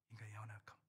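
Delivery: breathy whisper of the phrase. Real, not acted. Pastor whispering about human weakness to the congregation. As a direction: whisper it like a confession.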